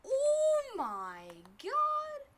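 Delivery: drawn-out high wail; panicking gamer. Real, not acted. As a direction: stretch it out in panic. Storm closing in.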